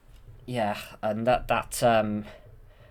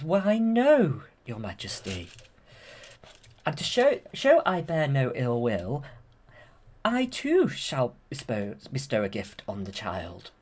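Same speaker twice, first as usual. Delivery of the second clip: Maleficent. Retold or imitated. Quoting Maleficent answering the Queen, falsely gracious villainess. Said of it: "and then Maleficent says".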